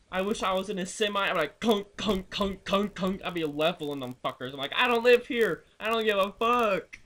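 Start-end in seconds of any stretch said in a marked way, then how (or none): 1.6-3.2 higher, louder thud sounds; imagining a semi leveling cows, thud sounds; mock thud noises. Imitating semi hitting cows. 4.8-7.0 loud, high mock shout; retold defiance about cow fines, loud; defiant outburst.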